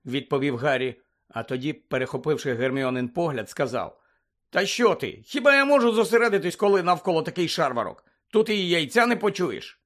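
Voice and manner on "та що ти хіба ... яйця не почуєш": high-pitched, indignant excuse; exasperated protest. A narrator's reading. Harry excusing himself to Hermione, exasperated. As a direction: argue back, voice pitched up.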